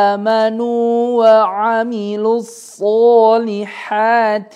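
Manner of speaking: melodic tajwid chanting; slow chanted recitation; Quran recitation. Shown as a demonstration. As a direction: recite the verse with care. Recites the verse correctly.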